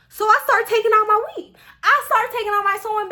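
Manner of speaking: high, loud, worked-up telling; defiant declaration. Retold or imitated. Student rushing to hair appointment after exam, loud high defiance. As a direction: raise pitch and volume, unapologetic. Defiant re-enactment of removing weave.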